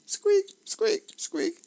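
very high-pitched repeated squeaks; squeaking chair. Retold or imitated. Narrator imitating Sergeant Snorkel's chair, high squeaks.

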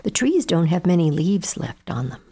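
ordinary delivery, nothing marked

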